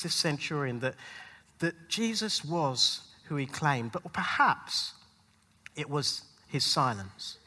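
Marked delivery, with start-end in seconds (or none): none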